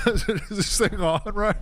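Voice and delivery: high, laughing question. Real, not acted. Streamer testing his new pop filter, laughing. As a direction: giggle through the mic check.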